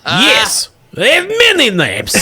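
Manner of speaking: high, laughing monster voice; a giggling ancient sea spirit; Leviathan spirit voice. Acted.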